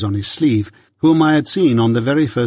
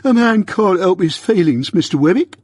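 high, pleading plea; pleading Mike; 7 semitones higher than usual. A narrator's reading. Mike pleads with Wemmick to excuse his tears, high and pleading. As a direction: plead for his feelings, higher. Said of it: "pleaded Mike".

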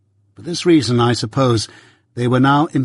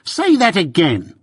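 higher, savage challenge; savage demand; 6 semitones higher than usual. A narrator's reading. Wemmick dares Mike to repeat himself, savage and higher. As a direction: dare him to repeat it. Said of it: "demanded Wemmick quite savagely".

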